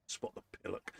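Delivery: self-mocking whisper; whispered aside. Real, not acted. [name] whispering a self-mocking aside about his mistake. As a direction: hush it as a joke at yourself. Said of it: "self-mocking aside".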